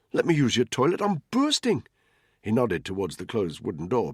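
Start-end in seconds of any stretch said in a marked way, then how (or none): 0.1-1.9 high, loud, desperate request; urgent pleading. A narrator's reading. Man begging cab controller for the toilet, urgent pleading. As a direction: plead as if about to burst.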